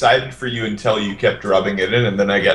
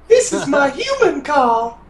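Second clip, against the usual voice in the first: very high, slow falsetto; mock female voice; slower than usual. Player demonstrates a voice for the group, slow mock-female falsetto. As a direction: draw it out in a put-on falsetto. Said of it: "You can do a female voice".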